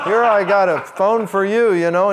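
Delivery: hammed-up proud dad handing over the phone; eager dad, laughing. Dad in a phone commercial giving his wife a phone, laughing and loud. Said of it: "He goes".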